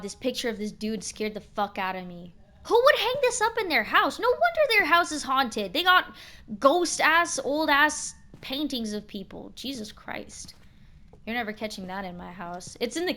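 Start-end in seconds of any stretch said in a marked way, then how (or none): 2.6-9.5 high, loud alarmed exclaiming; spooked and loud at a creepy portrait; freaked-out outburst. Real, not acted. Scared by creepy painting. 9.5-10.5 slow, breathless whispered Jesus Christ; hushed dread at the portrait; whispered oath. Real, not acted. Reacting to scary painting.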